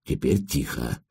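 low, soft, hushed voice; whispered warning. A narrator's reading. Bedrenets to his companion outside the burgled house, hushed warning. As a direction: drop low and soft to urge quiet. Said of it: "прошептал Бедренец".

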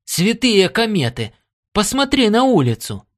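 excited call to a companion to look; amazed exclamation. A narrator's reading. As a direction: exclaim it, pitch leaping up. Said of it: "ирландец воскликнул".

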